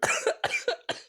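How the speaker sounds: spluttering cough from spice; harsh coughing fit. Real, not acted. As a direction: hack out a rough cough. Still coughing from fumes.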